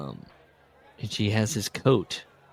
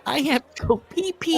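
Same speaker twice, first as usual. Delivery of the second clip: put-on pee-pee squeaked much higher, like a whiny child; mocking pee-pee. Shown as a demonstration. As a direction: parrot the line in a high, babyish mock voice. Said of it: "You went with pee-pee?".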